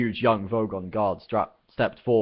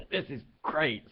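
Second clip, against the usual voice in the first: spluttering; 7 semitones higher, slower than usual. Acted. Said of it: "spluttered Arthur".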